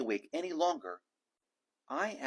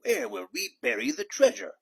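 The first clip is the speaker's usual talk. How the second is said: high, loud, despairing cry; desperate captain; 12 semitones higher, 7 dB louder than usual. A narrator's reading. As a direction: wail it over the storm.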